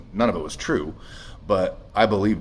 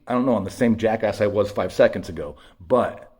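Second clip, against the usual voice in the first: ordinary delivery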